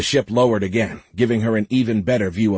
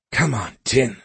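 ordinary delivery

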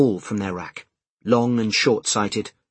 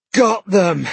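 loud, high, gasping triumph; gasp, then burst it out breathlessly; out of breath; 9 semitones higher, 7 dB louder than usual. A narrator's reading. Man arriving with glasses after running, breathless triumph. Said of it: "so out of breath he could barely speak".

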